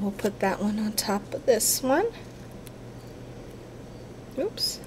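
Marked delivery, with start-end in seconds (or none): none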